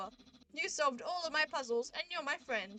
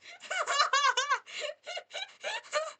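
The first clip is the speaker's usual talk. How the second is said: long shrieking laughter; loud high laugh; 13 semitones higher, 7 dB louder than usual. Real, not acted.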